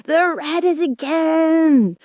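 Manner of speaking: high, drawn-out storybook shout. A narrator's reading. Messenger blurting news at the king's ear, a high slow shout. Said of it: "shouted at the top of his voice".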